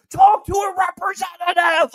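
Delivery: full-throated high shout at a phone robot; acted frantic yell into the phone; screaming. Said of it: "I'm always screaming".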